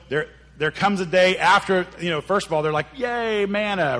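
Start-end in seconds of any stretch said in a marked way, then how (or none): 3.0-4.0 high, drawn-out cheer; mock delight. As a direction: cheer it like a happy crowd, stretching the yay. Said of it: "then their attitude changes to".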